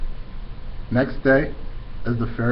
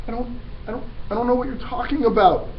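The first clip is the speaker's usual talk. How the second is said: puzzled; 12 semitones higher than usual. Acted. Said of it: "more puzzled than ever".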